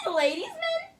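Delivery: giggly, high-pitched mock Irish accent; high Irish fairy voice. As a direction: play a tiny Irish fairy calling out. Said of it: "That sounds like some kind of fairy".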